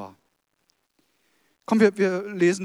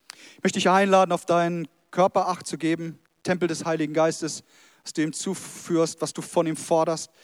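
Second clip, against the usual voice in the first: usual speaking voice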